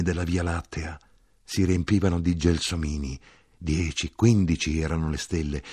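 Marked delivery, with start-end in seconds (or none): none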